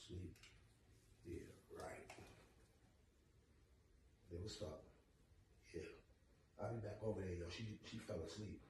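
very soft, low and hushed; hushed phone talk. Pretend. Boyfriend on the phone beside sleeping girlfriend, hushed. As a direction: keep it down so she stays asleep. Pretends she is asleep.

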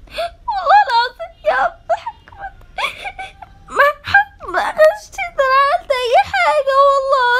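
high, sobbing plea of innocence; crying denial. Acted. Accused schoolgirl sobbing to her teacher. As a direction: beg through tears that you did nothing.

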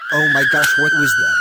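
very loud, shocked yell; startled shout. Acted. Startled by a scream from the punishment, shouting loudly. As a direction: blurt it out at full volume in alarm. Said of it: "That wasn't me screaming".

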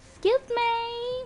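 very high, slow "Excuse me"; exaggerated request. Real, not acted. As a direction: stretch "Excuse me" out in a high pitch. Same request, exaggerated second take.

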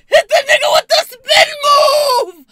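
gleeful hollering through laughter; triumphant shout. Retold or imitated. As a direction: scream it with joy, slow. Triumphant reliving escape.

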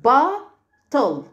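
"bottle" as drawn-out ba, pause, tol; split into syllables. Shown as a demonstration. Teacher repeats bottle for pupils, split in syllables. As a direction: pull "bottle" apart into two beats. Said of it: "يبقى two syllables".

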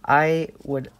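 normal talking voice

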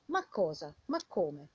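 ordinary delivery

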